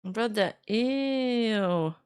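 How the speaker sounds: very slow, drawn-out disgust; a dragged-out grossed-out brother, ew. Real, not acted. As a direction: recoil at the cringe message. Reacts to pleading message.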